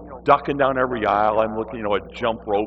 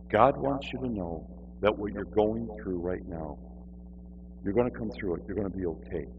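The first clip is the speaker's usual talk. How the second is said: soft, lower, calm comfort; gentle reassurance; 4 semitones lower, 7 dB quieter than usual. Retold or imitated. Reassuring woman after peace came.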